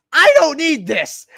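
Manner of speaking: loud, high, drawn-out mock tantrum; imitated angry outburst. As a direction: mimic an irate customer yelling. Retold angry Karen throwing the item.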